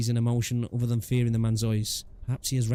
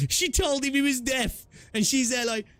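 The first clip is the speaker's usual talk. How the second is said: mock voice; 13 semitones higher, slower than usual. Mocking a deaf character's exchange.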